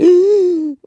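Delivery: loud, drawn-out startled gasp; mock waking gasp. Podcaster imitating herself waking from a nap, startled gasp. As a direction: suck in air as if jolted awake.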